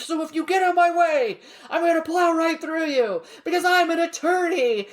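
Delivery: high, hammed-up tough-guy threat with a laugh; macho lawyer. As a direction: boast it like a brash ad lawyer. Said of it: "He's like".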